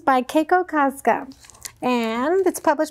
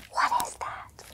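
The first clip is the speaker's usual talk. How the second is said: hushed suspense whisper; 12 dB quieter than usual. Acted.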